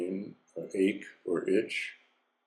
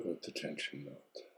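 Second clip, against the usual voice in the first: breathy whisper, fading out; soft spa whisper; 4 semitones lower, 14 dB quieter than usual. Acted. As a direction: let it melt on a whisper. Whispered release.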